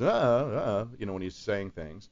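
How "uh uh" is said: a hint of Walken's clipped uh, uh; halting uh, uh like Walken; Christopher Walken's uh. Shown as a demonstration. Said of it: "He kinda goes".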